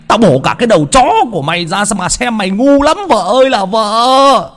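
very high, loud, frantic fury; raging yell. Acted. Husband threatening to chop up the maid, screaming in rage.

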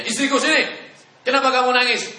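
loud, high mock call to the wife, half laughing; husband calling out. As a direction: call the crying wife over loudly. Suami memanggil istri menangis. (suami memanggil istrinya yang menangis, suara keras dan tinggi)

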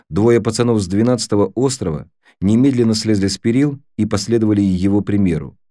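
ordinary delivery, nothing marked

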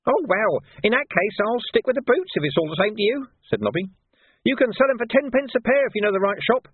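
a wheedling gutter lad taking offence; cheeky street urchin Nobby, aggrieved. Acted. Nobby's voice held.

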